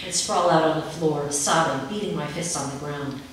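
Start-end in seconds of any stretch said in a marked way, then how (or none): none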